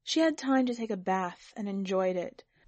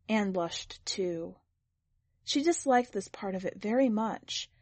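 ordinary delivery

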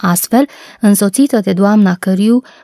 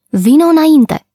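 pitched-up cheery call; cheerful; 8 semitones higher than usual. A narrator's reading. Boy invites the girl to come along, cheerfully. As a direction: call it out merrily, high. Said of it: "zise el vesel".

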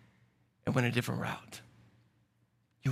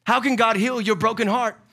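preaching; 5 semitones higher, 5 dB louder than usual. Real, not acted. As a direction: drive the point home from the pulpit. Preaching at full force.

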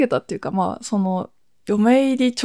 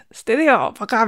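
mock-curt sister. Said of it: "みたいな。".

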